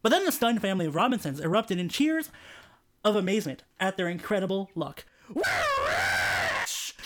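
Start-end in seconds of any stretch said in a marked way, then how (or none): 5.3-7.0 excited cry. A narrator's reading. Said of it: "cried a man Robinson".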